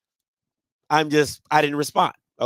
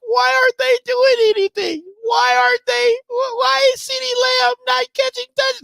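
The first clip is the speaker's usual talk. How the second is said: mock crying. Son crying to dad about the team, mock crying. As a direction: stammer and wail like an upset kid. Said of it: "like, he was crying".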